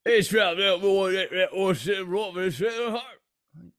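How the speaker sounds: over-the-top fake Cockney; bad Cockney accent. Co-host playing a bad Cockney Bond in a Cockney accent. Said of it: "your bad Cockney accent".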